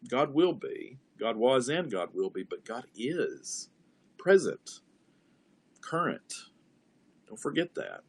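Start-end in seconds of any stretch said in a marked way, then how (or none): 2.4-6.3 slow "God is", then a raised "present, current"; stress on is. Shown as a demonstration. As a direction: lean on "is", then lift "present". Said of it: "go to the next word".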